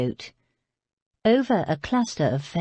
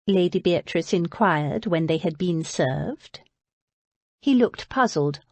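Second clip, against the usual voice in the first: plain reading voice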